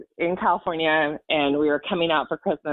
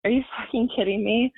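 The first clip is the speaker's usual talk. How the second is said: higher, disbelieving what-the-hell thought; incredulous inner outrage; 6 semitones higher than usual. Retold or imitated. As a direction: replay the shocked thought, pitched up. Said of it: "I thought".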